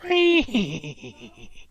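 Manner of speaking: high imitated horse whinny; whinny to finish a gallop demo. Shown as a demonstration. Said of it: "here is my gallop".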